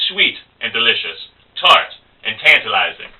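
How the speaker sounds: high, pompous drink order, opening on a throat-clear; affected barroom show-off, easing down at the end; would-be smart guy. Acted. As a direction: order as if reciting fine verse. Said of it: "he's trying to look smart".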